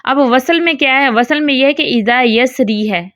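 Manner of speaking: usual speaking voice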